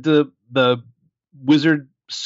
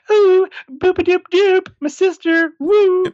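much higher, slow and louder, a hammed-up giddy flapper; mock Queenie; 5 dB louder, slower than usual. Podcaster mocking Queenie in the film, much higher and hammed up. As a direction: squeal it out like a swooning twenties starlet. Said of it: "Queenie shows up and she's like".

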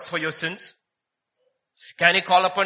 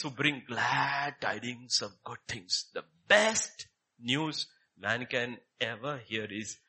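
tender preaching; 8 semitones lower, 7 dB quieter than usual. Acted. Preacher offering the good news, soft tender. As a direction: speak it gently as good news.

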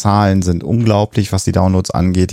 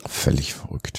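hushed, breathy astonishment; soft amazed whisper, almost to yourself; 5 semitones lower, 10 dB quieter, slower than usual. Real, not acted. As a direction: whisper it in disbelief. Stunned reaction to polar bear myth.

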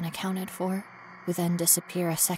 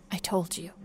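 ordinary talk, nothing marked

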